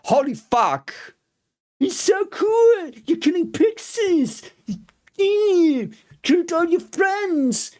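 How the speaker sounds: high, hammed-up cheering that mocks the upbeat music. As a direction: cheer it like a delighted fan, sarcastically. Mocking the music's cheerful mood.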